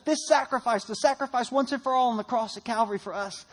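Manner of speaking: ordinary talk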